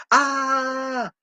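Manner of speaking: high drawn-out "ah"; imitated yell before falling over; mock falling scream. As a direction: hold a high cartoon cry. Acts out character falling over.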